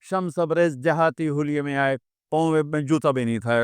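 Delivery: ordinary talk, nothing marked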